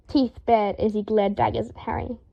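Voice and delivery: ordinary delivery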